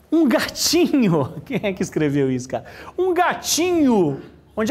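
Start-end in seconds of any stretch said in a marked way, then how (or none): 0.1-1.3 cooing. Real, not acted. Reading cute note slowly. 3.0-4.2 high, slow cooing. Real, not acted. Repeats note, cooing higher.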